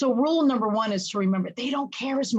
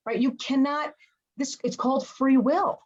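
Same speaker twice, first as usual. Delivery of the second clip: ordinary delivery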